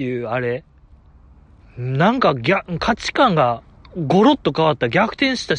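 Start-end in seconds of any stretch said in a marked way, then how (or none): none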